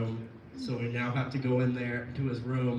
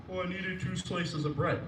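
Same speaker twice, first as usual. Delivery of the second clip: Alan impression; 6 semitones higher than usual. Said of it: "And he's like".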